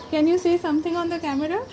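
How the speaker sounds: high, quick coaxing voice; talking to a toddler. Acted. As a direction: coax the child to speak. Mother asks child to speak.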